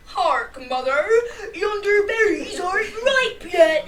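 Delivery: loud, hammed-up old-timey speech; mock archaic voice. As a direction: parody a theatrical medieval maiden. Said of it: "everything's like".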